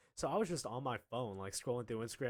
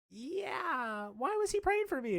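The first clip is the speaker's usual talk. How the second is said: high-pitched mock mom voice; hammed-up high questioning; slower than usual. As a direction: imitate a puzzled mother, pitched way up. Voices Timmy's mom questioning teacher.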